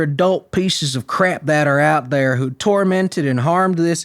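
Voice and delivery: a hint of the sheriff's Southern drawl, slow and emphatic; mock the sheriff's tough-guy Southern accent; sheriff's Florida accent. Vlogger mocking the sheriff's threat to suspects, in a lightly done Southern accent. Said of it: "I wanna do this in his accent".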